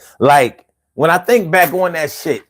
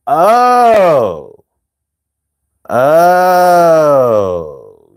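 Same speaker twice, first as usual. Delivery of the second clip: long drawn-out mock wail, louder; 4 dB louder than usual. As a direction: ham up a crying wail over makeup. Mock crying over makeup.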